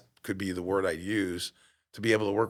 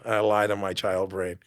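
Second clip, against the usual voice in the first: ordinary talk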